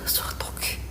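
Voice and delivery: hushed, breathless prayer under the breath; scared whisper in the dark; frightened whisper. Real, not acted. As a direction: whisper it so nothing hears you. Scared in dark, hearing crying.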